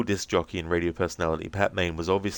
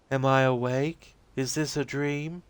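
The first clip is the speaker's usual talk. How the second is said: put-on high, slow, dreamy young woman's voice; spacey and childlike, drifting; dazed Rose; 8 semitones higher, slower than usual. Rose answering the agent's questions, dazed and high-voiced.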